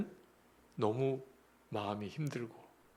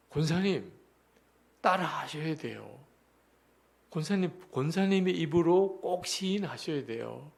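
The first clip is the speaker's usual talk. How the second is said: raised, higher, louder pleading command; insistent urging; 5 semitones higher, 6 dB louder than usual. Retold or imitated. Preacher urges weeping deaconess to repeat the confession, insistent. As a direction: press her firmly to say it herself.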